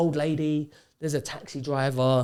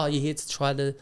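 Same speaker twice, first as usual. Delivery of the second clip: mimicking the guy; 2 semitones higher, 8 dB louder than usual. Retelling the guy's question.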